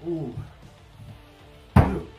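ordinary talk

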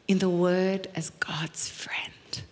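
soft, slow breathy whisper; awed whisper. Real, not acted. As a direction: hush in wonder. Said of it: "awed aside".

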